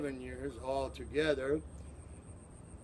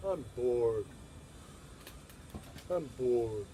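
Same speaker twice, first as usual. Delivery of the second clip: bored student; 5 semitones lower than usual.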